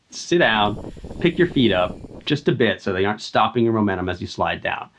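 ordinary talk, nothing marked

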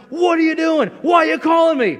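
loud, high-pitched shout imitating his own outburst; retold irritated yell. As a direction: yell it over the top, exasperated. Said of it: "I yelled at her".

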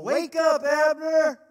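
high, loud shout calling down a hill; David shouting down to Abner from the hill; shouted taunt. Acted. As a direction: yell across the valley to wake him. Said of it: "he shouted down to Abner".

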